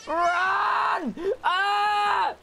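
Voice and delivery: yelling in panic; panicked shout. Real, not acted. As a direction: scream to run away. Panicked game chase.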